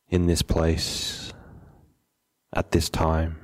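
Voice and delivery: unhurried meditation guide, long pauses between phrases; measured, settled meditation instruction; guided meditation. Acted. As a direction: calmly guide them to settle, leaving space after each phrase.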